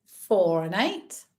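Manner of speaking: sing-song bingo number calls; bingo caller. Acted. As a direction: call each number out like a bingo hall. Online bingo calling numbers.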